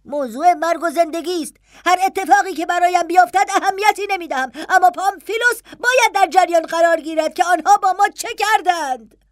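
high, urgent and building; impatient, rising insistence. Acted.

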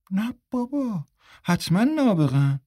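mock the boys with a twisted smirk; sneering sarcasm. A narrator's reading.

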